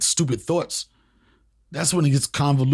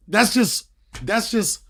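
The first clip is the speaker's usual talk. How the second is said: ordinary delivery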